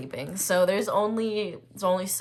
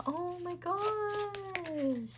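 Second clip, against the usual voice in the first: slow, soft, high drawn-out awe; awed; 11 semitones higher, 6 dB quieter, much slower than usual. Real, not acted. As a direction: breathe it out in wonder. Sees Ten of Cups.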